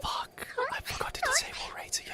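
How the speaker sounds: urgent panicked whisper. Real, not acted. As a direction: hiss it under your breath. Realizes raid is live.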